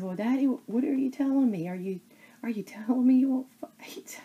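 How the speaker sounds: real tears, whispering through a choked voice; tearful whisper. Real, not acted. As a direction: voice breaking as she repeats her sister's plea. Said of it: "I'm so emotional".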